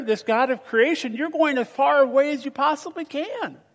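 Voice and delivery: normal talking voice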